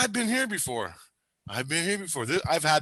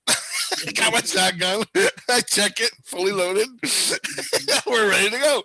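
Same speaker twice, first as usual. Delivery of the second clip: giggling through the story; real laughing; 6 semitones higher than usual. Real, not acted. Man amused by his own dream shotgun, laughing as he talks. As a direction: laugh between phrases, pitch lifted.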